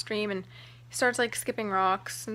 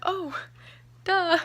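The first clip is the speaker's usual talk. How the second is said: higher-pitched, flustered sudden realization; excited; 6 semitones higher than usual. Retold or imitated. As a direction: re-enact giddily remembering the forgotten ring. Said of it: "I was so, so excited".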